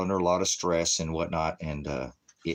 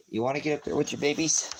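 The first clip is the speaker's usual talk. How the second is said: higher, quick, teasing question; quoted divine voice; 8 semitones higher, faster than usual. Retold or imitated. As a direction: toss the question up high and fast. God's words quoted to the speaker.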